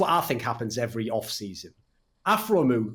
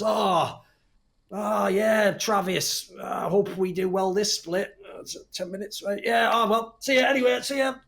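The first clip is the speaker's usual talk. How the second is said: high-pitched mock-cheerful player voice; put-on breezy player; 8 semitones higher, slower than usual. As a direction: pitch it up and act falsely upbeat. Acts player feigning cheer to teammate.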